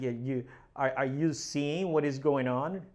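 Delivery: ordinary talk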